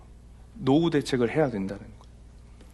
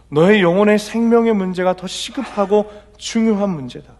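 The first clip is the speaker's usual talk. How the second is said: higher, louder preaching voice; forceful preaching; 8 semitones higher, 7 dB louder than usual. Acted. Pastor voices the Lord rebuking the rich fool, preaching loudly. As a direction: press the rebuke home from the pulpit.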